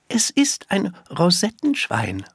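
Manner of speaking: put-on high child's voice, showing off; high-voiced little girl presenting her pet. A narrator's reading. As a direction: pitch it up as a small girl.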